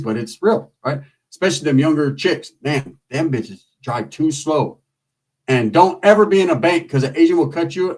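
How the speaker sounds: normal talking voice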